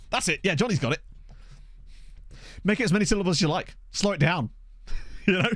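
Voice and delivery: ordinary talk, nothing marked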